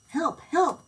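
high, loud cry for help. A narrator's reading. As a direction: call out in a panic. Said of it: "cried the page".

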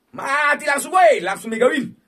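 loud, high whoop; excited. Real, not acted. Streamer cheering as the mega win hits, loud and high.

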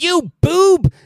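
high, loud exasperated insult; Skeletor scolding. Retold or imitated. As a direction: spit it at a henchman. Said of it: "he'll be like".

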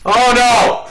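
loud, high cry of dismay; alarmed yell. Real, not acted. Player hit by a sudden mishap, loud alarmed yell. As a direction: yell out as it all goes wrong.

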